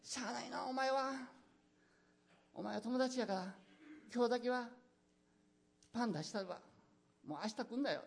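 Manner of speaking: 大阪弁でしぶしぶ; grudging Osaka dialect. Pretend. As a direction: friend grumbling but handing over bread in Osaka dialect. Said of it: "こんな感じですね".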